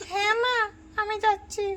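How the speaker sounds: put-on high, bright little girl answering mother; child voice. Daughter answering her mother in a high child voice. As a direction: pitch up into a young daughter's voice.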